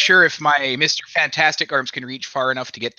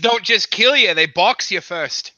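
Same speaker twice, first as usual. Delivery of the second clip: put-on Australian accent; 6 semitones higher than usual. Joking to podcast friends about Aussie zombies, in a put-on Australian accent. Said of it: "That was the best Australian accent ever".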